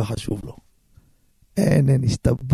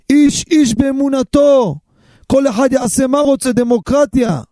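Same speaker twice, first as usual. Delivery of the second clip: mocking singsong; 7 semitones higher, 3 dB louder than usual. Mocking secular reply.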